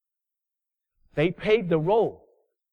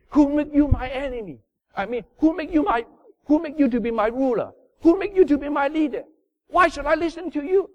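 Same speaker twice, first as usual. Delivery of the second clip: higher, louder indignant challenge; defiant rebel; 7 semitones higher, 4 dB louder than usual. Retold or imitated. Preacher voicing Israelites defying Moses, indignant. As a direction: throw the questions back at the leader.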